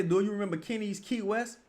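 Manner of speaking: usual speaking voice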